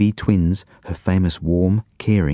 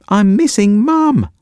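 put-on high, slow, louder child's yell from far away; distant yell; 13 semitones higher, 5 dB louder, slower than usual. A narrator's reading. Leo yelling from the toilet to his brother, high drawn-out yell. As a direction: wail it from down the hall. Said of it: "came Leo's distant yell".

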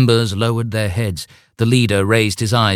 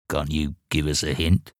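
shy, soft question; 4 semitones lower, 6 dB quieter than usual. Acted. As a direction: ask timidly, softly. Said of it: "Murder asked shyly".

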